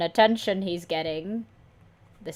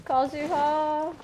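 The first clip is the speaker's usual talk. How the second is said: high, slow drawn-out call of Kazuha; dramatic call; 9 semitones higher, slower than usual. Acted. Fan calling out to Kazuha across the town, high and drawn out. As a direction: stretch the name out high.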